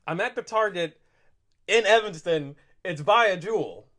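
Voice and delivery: angry. Retold or imitated. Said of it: "you can hear the anger in my voice".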